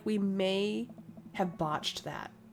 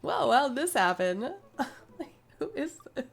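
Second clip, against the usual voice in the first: ordinary talk, nothing marked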